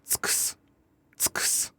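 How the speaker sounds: tsuks with every u voiceless; devoiced vowels. Shown as a demonstration. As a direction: say tsuks as pure consonant hiss, no vowels. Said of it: "final pronunciation would be".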